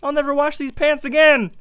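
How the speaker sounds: high mock shout from the crowd; fan in the crowd. Soda-sprayed fan calling from the crowd, mock thrilled shout. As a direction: call out like a thrilled onlooker. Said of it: "I heard from the crowd".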